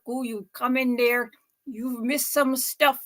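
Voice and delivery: normal talking voice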